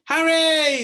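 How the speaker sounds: high, loud excited cry; excited shout. A narrator's reading. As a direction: call out in delight. Children cry out the dog's name.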